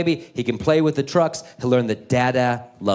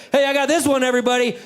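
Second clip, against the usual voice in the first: put-on eager kid; 11 semitones higher, faster than usual. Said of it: "Malcolm's in the back like".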